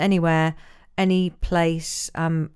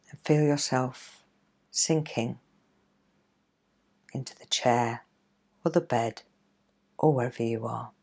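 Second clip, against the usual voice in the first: guided meditation; 3 dB quieter than usual. Acted. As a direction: ease them into calm, gently. Leads a guided relaxation.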